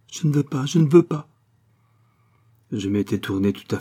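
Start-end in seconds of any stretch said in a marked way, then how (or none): none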